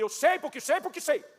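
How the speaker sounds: answering his friend's question with stubborn repetition, slow and loud; stubborn insistence. Retold or imitated.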